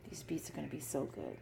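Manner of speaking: soft unvoiced whisper. Real, not acted. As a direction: whisper it like a secret. Aside to camera after tagline.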